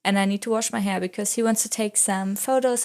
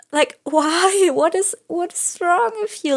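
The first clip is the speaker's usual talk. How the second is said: incredulous disbelief; 8 semitones higher than usual. Retold or imitated. Retelling a shocking teacher question.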